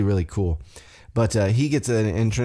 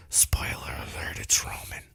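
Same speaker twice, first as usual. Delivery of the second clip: whisper; 8 semitones lower, 8 dB quieter than usual. Real, not acted. Host leaks the match winner to listeners, whispered. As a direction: whisper the spoiler under the breath. Aside revealing the match result.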